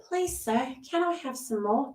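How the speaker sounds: high, timid little plea; meek pleading. Retold or imitated. Reader imitating the returning ex's meek plea. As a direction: beg softly like a hungry orphan. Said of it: "coming in meek like".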